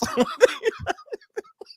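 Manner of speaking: long, high-pitched laughing fit; high helpless laughter; real high laugh. Real, not acted. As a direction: crack up after your own singing. Cracks up at own parody.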